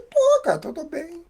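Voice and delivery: high, proudly insisting he's fine; proud brush-off. Retold or imitated. A proud man telling a caring brother on the phone he's fine, mock proud reassurance. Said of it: "cheio de orgulho ainda".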